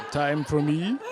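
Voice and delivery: a loud, laughing hint of a Transylvanian vampire; vampire tree in Transylvanian accent, half laughing. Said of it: "My fake tree has a Transylvanian accent".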